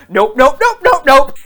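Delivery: loud, rising string of nopes, imitating panic; mock terrified. Imitating husband running from the room, mock terrified. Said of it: "he ran terrified from the room".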